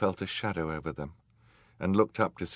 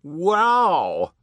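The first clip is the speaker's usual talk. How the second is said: high, loud thrilled squeal; 12 semitones higher, 10 dB louder than usual. A narrator's reading. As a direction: squeal with excitement. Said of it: "squealed another voice".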